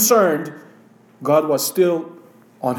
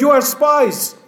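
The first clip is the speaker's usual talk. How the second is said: raised, pointed charge; harsh accusation; 6 semitones higher than usual. A narrator's reading. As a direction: snap the accusation high. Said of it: "He spoke to them harshly".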